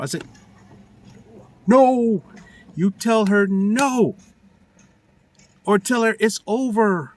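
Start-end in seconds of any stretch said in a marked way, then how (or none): 1.7-4.2 NO said louder and higher, then slow; firm insistence. Real, not acted. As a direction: lay it down slowly, word by word. Telling him what to say.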